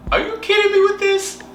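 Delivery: high-pitched mock disbelief; incredulous. Contact answering the panicked model, high imitated incredulity. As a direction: push back in exaggerated astonishment.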